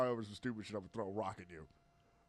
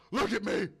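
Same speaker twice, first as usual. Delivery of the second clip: defiant dying man; 6 semitones higher, 3 dB louder than usual. Retold or imitated. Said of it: "he's like".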